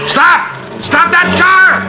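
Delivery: high, loud yell after a fleeing car; urgent shouted command. Acted. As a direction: yell to stop them.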